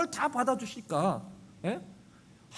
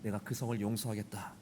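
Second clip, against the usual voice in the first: God answering; 10 semitones lower than usual. Retold or imitated. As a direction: speak as God, low and calm. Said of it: "하나님께서". (낮고 조용한 하나님의 목소리)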